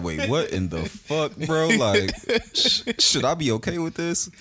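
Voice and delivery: startled and high, slowing on the first words; mock shock. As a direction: act caught off guard by a sudden outburst. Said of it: "You'll be like".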